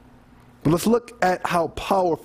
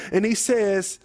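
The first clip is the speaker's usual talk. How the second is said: ordinary talk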